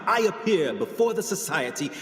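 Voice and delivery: triumphant showman's announcement; rousing lecture. Acted. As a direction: proclaim it to the whole society. Triumphant end of his lecture.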